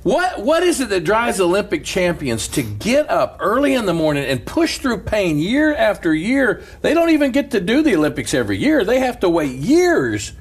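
preaching. Acted. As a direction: preach it out to the church with fervour. Preaching at full force.

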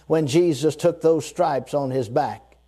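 ordinary talk, nothing marked

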